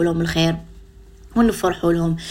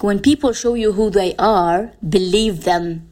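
quoting Oprah; much slower than usual. Retold or imitated. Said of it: "كيما قالت اوبرا".

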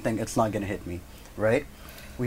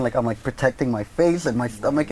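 usual speaking voice

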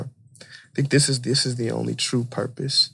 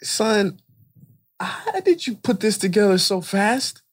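high-pitched, incredulous question; baffled father; 9 semitones higher, 3 dB louder than usual. Acted. Father to son over finished puzzle, baffled. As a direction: play a dad stumped and amazed.

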